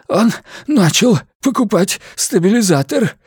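breathless, wheezing high voice; gasping wheeze. A narrator's reading. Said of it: "задыхаясь, прохрипел Фим".

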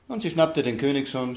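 ordinary delivery

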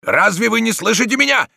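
high, forceful yell; loud shout. A narrator's reading. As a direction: shout to be heard over fighting. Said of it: "громко закричал Дон Иниго". (громкий крик)

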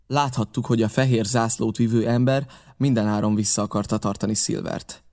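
ordinary delivery

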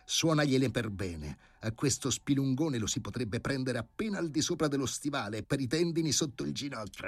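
the dog's thrilled inner voice; enthusiastic dog. A narrator's reading. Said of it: "pensò il cane in preda all'entusiasmo". (cane entusiasta, più acuto)

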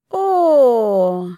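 loud fake delighted "О!"; mock exclamation. A narrator's reading. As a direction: exclaim with sneering surprise. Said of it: "воскликнул первый". (громкое насмешливое восклицание)

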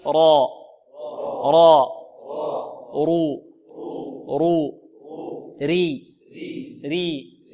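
heavy ra in را and رو, light ra in ري; drilled ra syllables. Shown as a demonstration. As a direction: chant را رو ري for the class, slowly. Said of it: "هكذا انطقوا معي". (تكرار الراء مفخمة ومرققة)

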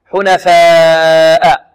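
loud, very long held aa in حنفاء, ending in a glottal stop; connected madd. Shown as a demonstration. Teacher demonstrating the madd, long vowel. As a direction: stretch حنفاء out strongly on one note. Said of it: "حنفاء كذلك مد متصل".

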